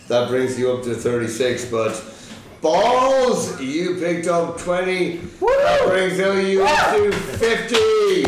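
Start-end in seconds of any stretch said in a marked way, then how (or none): 2.6-8.2 high, drawn-out winner's reveal; hyped score announcement. Real, not acted. Quiz host crowns the winning team, hyped and drawn out. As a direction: stretch it out, building to a big cheer.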